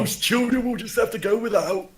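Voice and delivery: high, loud pitying wail; mock grief. Player voices cultist lamenting for the children, high mock grief. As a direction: lament it with hammed-up sorrow.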